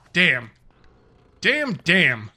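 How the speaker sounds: punchy, loud exclamation; quoted exclamation, punched out; imitated catchphrase. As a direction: hit each "Damn" hard, falling. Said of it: "No, it was damn".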